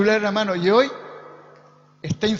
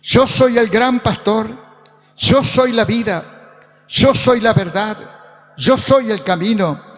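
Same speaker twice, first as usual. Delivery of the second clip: loud, rhythmic proclamation; forceful preaching; 7 dB louder than usual. Real, not acted. Preacher proclaims Jesus's "I am" sayings to congregation, forceful preaching. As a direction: hammer out each declaration at full voice.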